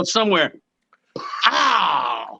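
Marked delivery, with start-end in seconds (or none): 1.5-2.4 a retold yelp of getting hit; mock cry of pain. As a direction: yelp like you just got clocked.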